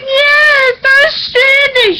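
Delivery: high, slow, whiny wail; mock distress. Telling mom she's stranded by rain, high mock wail. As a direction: play the stranded victim for laughs. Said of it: "I was like".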